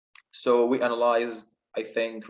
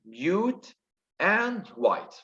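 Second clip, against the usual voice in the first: higher, separated words; syllable by syllable; 7 semitones higher, much slower than usual. Shown as a demonstration. Teacher counting up to ten syllables, syllable by syllable. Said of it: "seven and three".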